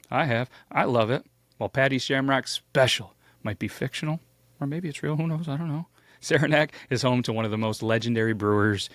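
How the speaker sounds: usual speaking voice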